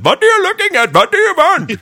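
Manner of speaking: very high, loud mock Indian accent. Host imagines confronting the staring lady, yelling in mock Indian accent. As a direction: challenge them in a squealed, put-on Indian accent. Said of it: "broke out in this Indian voice".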